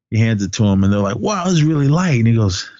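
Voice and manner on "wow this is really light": higher-pitched impressed wow; retold amazement. Hunter handling the gun, mock amazement.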